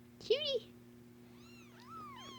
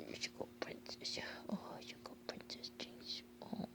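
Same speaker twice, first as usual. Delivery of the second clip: slow, hushed whisper of praise; soft praise whisper; 13 dB quieter, slower than usual. Real, not acted. Owner hushing praise to the mother dog, slow whisper. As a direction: murmur softly to soothe the mother dog.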